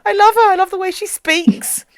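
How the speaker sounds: high, loud fangirl squeal of delight; breathless adoring gush about the actress. Retold or imitated. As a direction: rave about her like a smitten fan. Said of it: "and it's just like".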